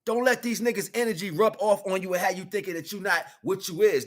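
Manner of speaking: usual speaking voice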